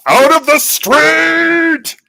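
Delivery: loud ranger shout; shouting warning. Retold or imitated. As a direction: yell them out of the street. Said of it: "I immediately am like".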